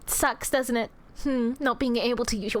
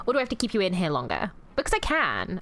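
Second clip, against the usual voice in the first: normal talking voice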